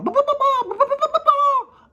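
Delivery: very high loud clucking ba ba; panicked hen squawk. Acted. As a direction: cluck wildly like a startled hen. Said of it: "the hen started going".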